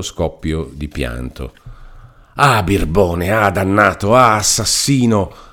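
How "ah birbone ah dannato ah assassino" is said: angry cries, louder and rising; furious shouting. A narrator's reading. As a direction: hurl each insult harder. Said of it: "gridava Renzo".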